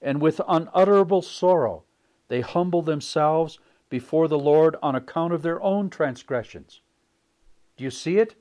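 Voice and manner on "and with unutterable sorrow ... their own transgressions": preaching read. Acted. Reads quote in preaching voice.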